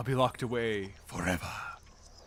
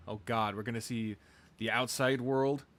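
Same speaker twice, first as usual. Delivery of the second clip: ordinary talk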